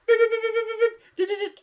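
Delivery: high-pitched rapid computer beeps; computer beeping. Acted. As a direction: mimic a TV crime-lab computer, high beeps. Mimicking CSI computer enhancing an image.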